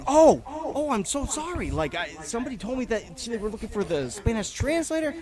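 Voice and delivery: hammed-up apologetic agent; mock flustered lady. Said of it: "And she's like".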